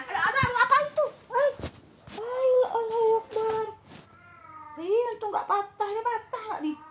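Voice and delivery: high-pitched flustered yelps; startled, squeaky exclaiming; real startled alarm. Real, not acted. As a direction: react to a sudden mishap, voice shooting up. Something falls, may be broken.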